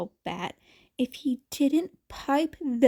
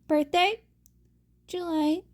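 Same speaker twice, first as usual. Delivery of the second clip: usual speaking voice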